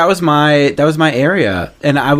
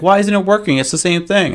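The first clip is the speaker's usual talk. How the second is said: complaining customer; 8 semitones higher than usual. Retold or imitated.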